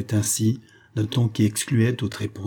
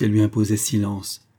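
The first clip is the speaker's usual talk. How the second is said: plain reading voice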